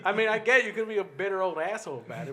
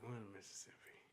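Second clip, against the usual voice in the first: whispering; 7 semitones lower, 24 dB quieter than usual. Retold or imitated. Staff counting groups in whisper.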